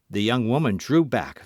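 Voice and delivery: ordinary delivery, nothing marked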